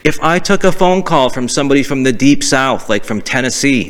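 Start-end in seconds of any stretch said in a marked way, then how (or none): none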